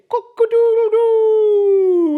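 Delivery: very high, loud, stretched rooster call; rooster crow. Acted. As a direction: crow it out like a cockerel. Rooster crows at morning.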